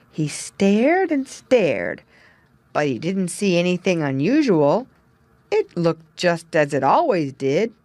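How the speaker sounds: usual speaking voice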